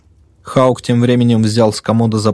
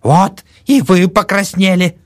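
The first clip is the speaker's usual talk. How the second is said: put-on gleeful old woman; 11 semitones higher, 4 dB louder, slower than usual. A narrator's reading. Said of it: "заметила старуха".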